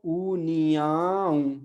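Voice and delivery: ऊनियाओ very slow, the final ओ through the nose; nasal ending. Shown as a demonstration. Teacher draws out the nasal ओ for the class. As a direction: stretch ऊनियाओ and nasalise its end. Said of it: "नाक के साथ बोलना है".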